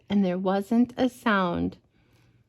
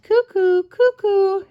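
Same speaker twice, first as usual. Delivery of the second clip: high, louder cuckoo call; cuckoo call imitation; 10 semitones higher, 6 dB louder than usual. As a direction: sing out cuckoo up high and loud. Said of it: "I'm not very good at bird sounds".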